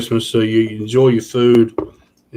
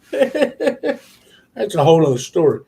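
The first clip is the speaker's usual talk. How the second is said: laughing; 7 semitones higher, slower than usual. Real, not acted. Laughing while speaking.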